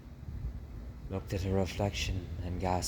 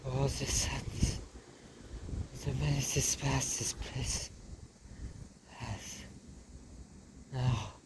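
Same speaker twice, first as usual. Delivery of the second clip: high, dramatic French mother; French mother mouse; 7 semitones higher, 4 dB quieter than usual. Acted. Mother naming her son, high and theatrical. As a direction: play her as a vain diva.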